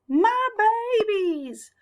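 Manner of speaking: high, slow, drawn-out mother duck cry. Acted. Mother Duck finding her ducklings, high and drawn out. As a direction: cry out for your babies.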